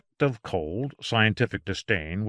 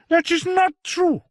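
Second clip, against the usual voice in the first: very high, loud protest; cried out; 6 dB louder than usual. Acted. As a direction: shriek it indignantly. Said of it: "he cried".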